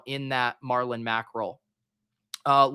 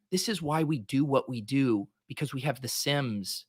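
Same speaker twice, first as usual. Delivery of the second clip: cult leader; 4 dB quieter than usual. Pretend. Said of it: "I sound like this, this cult leader".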